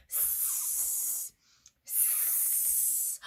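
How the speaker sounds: long, soft hissing; snake hiss. Shown as a demonstration. Making a snake sound with children, long hiss. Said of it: "Should we make a sound like a snake?".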